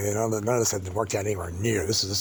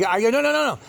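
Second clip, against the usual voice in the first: retold panicked shouting; 13 semitones higher, 6 dB louder than usual. Being handcuffed, protesting.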